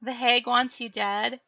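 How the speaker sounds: ordinary talk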